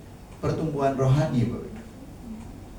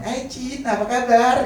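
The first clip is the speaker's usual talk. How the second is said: high, swishy mock greeting, much higher; mocking effeminate greeting; 10 semitones higher than usual. Preacher mocking a man's effeminate greeting, high and teasing. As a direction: mimic a limp-wristed man saying hi. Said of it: "Masa laki-laki ngomong".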